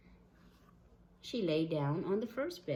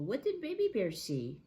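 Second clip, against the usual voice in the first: plain reading voice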